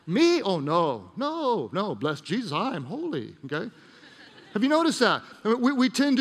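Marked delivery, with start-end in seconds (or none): 0.1-3.7 swooping mock-pious denial; mock self-righteous. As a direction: play holier-than-thou.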